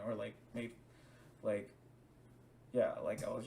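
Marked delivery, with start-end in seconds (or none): none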